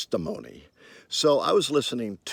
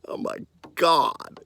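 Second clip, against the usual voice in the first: retold shock; 9 semitones higher than usual. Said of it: "I went".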